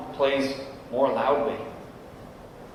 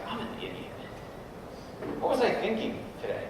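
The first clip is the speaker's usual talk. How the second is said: muttering to himself; 9 semitones higher, 8 dB quieter, faster than usual. Retold or imitated. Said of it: "I'm like".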